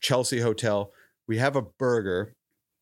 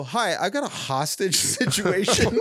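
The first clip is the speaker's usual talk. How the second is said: laughing while telling; 7 semitones higher than usual. Retold or imitated. Retold call to cops, laughing.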